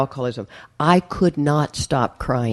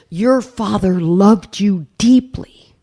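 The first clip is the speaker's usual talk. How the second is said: slow, higher, each word weighted; retold heartfelt assurance, pitched up and drawn out; earnest insistence; 5 semitones higher, much slower than usual. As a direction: press the truth home, slowly and emphatically. Stepmom's retold reassurance, acted out.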